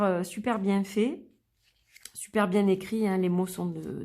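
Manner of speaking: usual speaking voice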